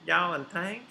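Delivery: put-on questioning y'all in a Southern accent; Southern accent, rising; Southern accent try. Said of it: "work on your Southern accent".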